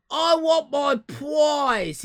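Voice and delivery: high, drawn-out loud demand; demanding shout. Pretend. Game host playing someone claiming a prize, high demanding shout.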